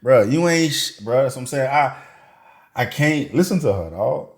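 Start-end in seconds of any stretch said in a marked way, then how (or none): none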